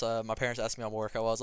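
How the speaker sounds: ordinary talk, nothing marked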